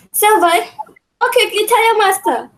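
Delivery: high, loud summons; urgent calling out. Acted. Queen sending servant to tell the master, urgent excitement.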